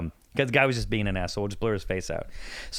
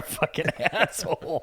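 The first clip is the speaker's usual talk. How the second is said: laughing; 3 semitones higher, slower than usual. Retold or imitated. Retelling calling the guy out.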